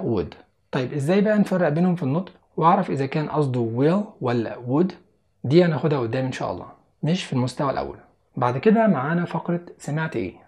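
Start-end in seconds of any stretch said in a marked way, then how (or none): none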